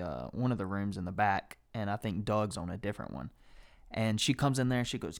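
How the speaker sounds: normal talking voice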